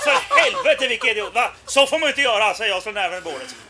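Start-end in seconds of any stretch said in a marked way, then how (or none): 0.0-2.5 loud hammed-up indignation; mock-furious, jumping high in pitch; feigned outrage. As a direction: protest loudly as if appalled. Acted outrage, fist on table.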